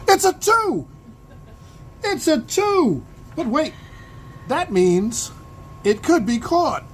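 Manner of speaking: high, loud play-by-play excitement; excited home-run call. Acted. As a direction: shout it like a commentator as the ball flies. Calls a possible home run.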